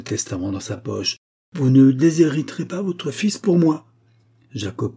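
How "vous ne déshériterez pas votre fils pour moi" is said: loud, high protest; raised, vehement voice; crying out. A narrator's reading. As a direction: refuse loudly and firmly. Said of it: "s'écria Agar".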